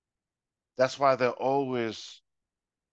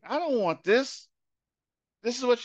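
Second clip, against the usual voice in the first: angry son; 10 semitones higher than usual. Retold or imitated. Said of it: "he got really upset, got angry".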